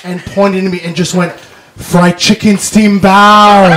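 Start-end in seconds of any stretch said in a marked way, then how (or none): 1.8-3.7 slow, drawn-out call of the order; pointing it out. Retold or imitated. Stranger on stairs, spotting him, slow teasing call. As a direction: spell it out slowly like a nickname.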